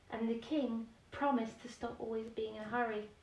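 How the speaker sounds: ordinary delivery, nothing marked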